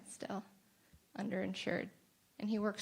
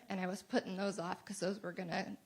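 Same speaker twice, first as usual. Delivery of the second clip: ordinary talk, nothing marked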